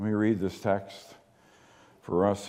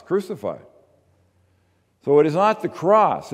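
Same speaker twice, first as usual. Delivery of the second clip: ordinary talk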